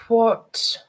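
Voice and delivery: ordinary delivery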